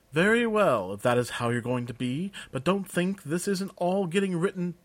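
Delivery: high, sniffy parting shot; huffy clerk. Acted. Clerk Jurgen backing down but vowing to report it, high and huffy. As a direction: flounce off with a final jab.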